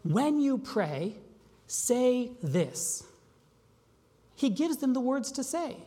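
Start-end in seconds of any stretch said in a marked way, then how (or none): 0.0-3.0 drawn out slowly, leaning on each word; slow, emphatic quote. Retold or imitated. Jesus instructing disciples, said slowly. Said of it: "He says".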